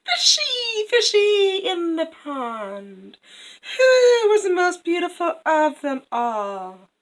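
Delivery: high, loud, drawn-out chant; sing-song call to the fish, slowly; queen's incantation. Acted. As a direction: vain queen calling out in a high, swooping voice. Queen calls to fish again.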